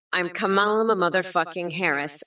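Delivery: ordinary talk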